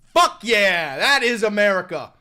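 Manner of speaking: loud, fired-up cheer; fist-pump shout of approval. Real, not acted. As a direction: cheer him on with full force. Cheering the angry voter.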